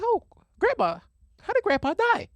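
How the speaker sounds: very high-pitched retold question; mock innocent question. Asking grandma how grandpa died, in a high mock-innocent voice.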